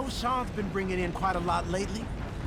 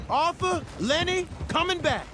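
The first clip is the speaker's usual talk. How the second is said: calling out; 9 semitones higher than usual. Acted. Calling out to camp guard.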